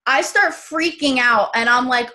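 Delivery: usual speaking voice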